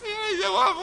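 high-pitched mocking wail; mock plea. Preacher mimics self-styled humble man, high mock plea. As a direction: put on a high, whiny plea.

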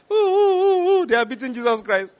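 high mock keening, pitched well up; a preacher's high, hammed-up lament; mock wailing. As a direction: imitate the mourning women wailing ooh. Women weeping as Jesus is beaten.

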